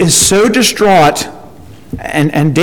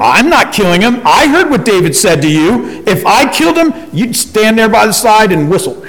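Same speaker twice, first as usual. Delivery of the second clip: higher, protesting servant voice; the servant refusing, pitched up in alarm; indignant servant; 5 semitones higher than usual. Retold or imitated. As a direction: servant pushing back at Joab, high and defensive. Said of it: "the servant that found him".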